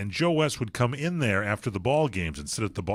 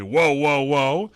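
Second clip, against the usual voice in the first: mock whoa; 4 semitones higher, 7 dB louder, much slower than usual. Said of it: "he says".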